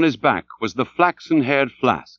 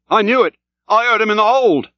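high-pitched, raised crewman's voice; sailor calling back; 10 semitones higher, 3 dB louder than usual. A narrator's reading. Archie answers his officer, calling back high. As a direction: answer up from the oars. Oarsman continues his reply.

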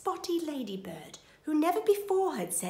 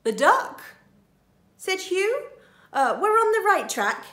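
thief voice; 9 semitones higher, 8 dB louder than usual. Acted. Thief Hugh speaking.

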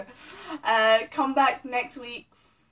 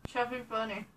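garbled through a stuffed mouth; mouth full; 9 dB quieter, faster than usual. Real, not acted. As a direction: cheeks packed with marshmallows.

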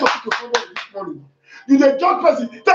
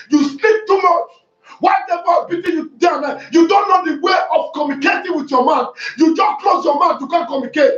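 scolding shout; 6 semitones higher than usual. Acted. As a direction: scold them for their laziness. Scolding the congregation.

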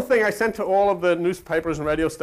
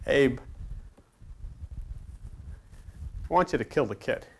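deep God voice; 6 semitones lower, 8 dB quieter than usual. Acted. God orders Abe to kill the kid, in a low grave voice. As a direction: speak as a solemn God handing down an order.

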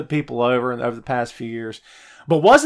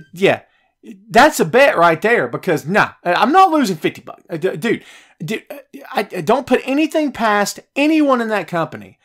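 ordinary talk

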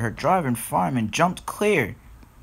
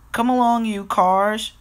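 higher, louder call to the cars; cheerful call; 10 semitones higher, 4 dB louder than usual. A narrator's reading. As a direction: call them along brightly, pitched up. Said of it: "she smiled".

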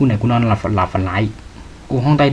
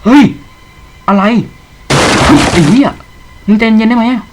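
higher, loud alarmed yelling at the monster; panicked yelling; 8 semitones higher, 7 dB louder than usual. Real, not acted. Streamer chased by a monster, yelling in alarm. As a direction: shout in real alarm.

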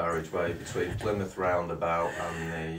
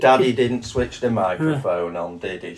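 loud teasing; 3 semitones higher, 11 dB louder than usual. Real, not acted. Teasing dad on camera.

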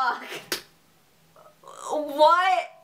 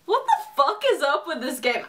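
normal talking voice

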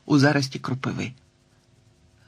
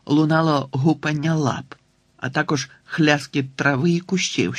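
plain reading voice

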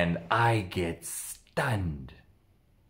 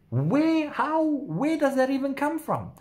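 amazed disbelief. Real, not acted. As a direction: ask it as if you can't believe it. Shocked by her new ability.

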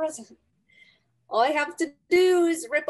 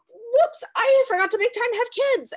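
ironic whoops; 10 semitones higher, 4 dB louder than usual. Acted. Ironic doom spiral.

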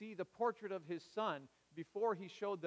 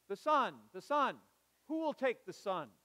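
louder, higher call to the room; auctioneer's plea; 4 semitones higher, 7 dB louder than usual. Retold or imitated. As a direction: keep pressing the crowd for a bid. Auctioneer pleading for a bid.

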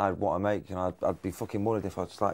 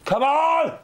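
rising to a much higher, louder shout; yelling at the hoover; 6 dB louder, much slower than usual. Retold or imitated. Kicking the Hoover in the studio, loud high yell. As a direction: egg the machine on, shouting. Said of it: "To the Hoover".

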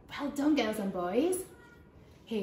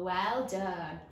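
normal talking voice

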